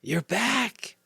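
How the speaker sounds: put-on high, small sick-child voice; small weak voice. A narrator's reading. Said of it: "in a voice as small as a whisper".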